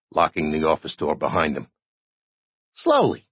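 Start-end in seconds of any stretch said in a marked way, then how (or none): 2.8-3.3 high-pitched admission; small, high character voice; Digger. A narrator's reading. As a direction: concede it in a high little voice. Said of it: "Digger admitted gloomily".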